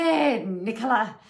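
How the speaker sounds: slow, fond imitation of nana's English accent. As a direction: say her name the way an English nana would. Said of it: "is how she says it".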